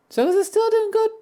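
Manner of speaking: squeaky, loud self-quote; high mock query. Streamer quoting themselves checking the video, high mock query. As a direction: put on a very high voice to ask.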